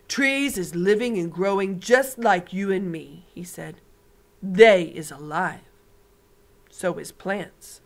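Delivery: big friendly giant showing off his hearing; booming giant. Acted. BFG voice again.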